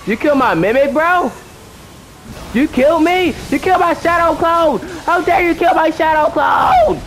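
mock outrage. Real, not acted. Gamer scolds the boss for killing his Mimic, mock outrage.

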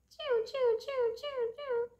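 high-pitched imitated bird chirps; bird chirping.

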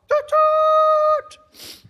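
very high, blaring imitated horn; short toot, then a loud held one; excited train whistle. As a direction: whoop it out like a train blast. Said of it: "We're so excited".

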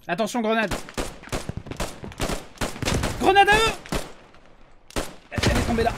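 loud, high alarm call; grenade warning yell. Real, not acted.

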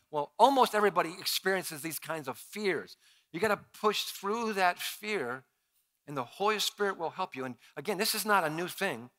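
ordinary delivery

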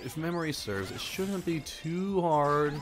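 ordinary talk, nothing marked

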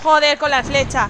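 loud, slow exasperated curse; frustrated outburst. Real, not acted. Player cursing a missed arrow shot, loud and slow. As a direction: spit out the curse at the wasted arrow.

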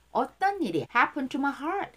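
ordinary delivery

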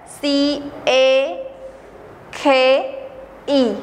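slow, with a pause after each letter; spelled out letter by letter. Shown as a demonstration. Teacher spelling cake for the class, letter by letter slowly. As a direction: spell C A K E out one letter at a time, then say the word. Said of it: "can you spell the word cake".

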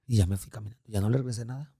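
ordinary delivery

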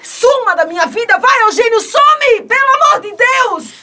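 high, loud screaming plea; shrieking, near hysterical, begging him to go; desperate shout. Acted. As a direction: scream him out of your life. Hortência screams at Eugênio to leave.

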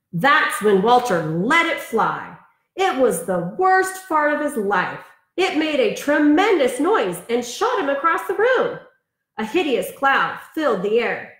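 lively storybook read-aloud; reading to children. Acted. Teacher reading a picture book to her class, in a lively storybook voice. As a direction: read it like bedtime story time for kids.

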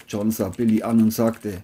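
ordinary delivery

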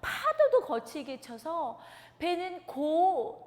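ordinary delivery, nothing marked

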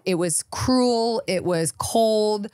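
cruel and cold each drawn out slowly; slow emphasis. Real, not acted. Stressing the harsh breakup.

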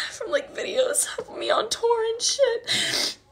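high, tearful whisper; barely holding back tears; voice breaking. Real, not acted. Crying, voice breaking.

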